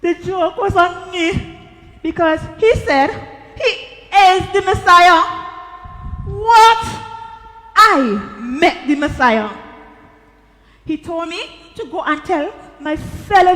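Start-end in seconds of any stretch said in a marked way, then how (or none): 4.2-9.5 loud, amazed exclamation; astounded. Acted. Woman realises she met the Messiah, astounded. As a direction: burst out in big, stunned disbelief.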